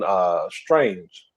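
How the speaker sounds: ordinary talk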